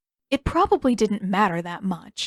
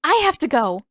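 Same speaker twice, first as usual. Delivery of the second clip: urgent announcement; 6 semitones higher, 4 dB louder than usual. A narrator's reading. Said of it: "she announced".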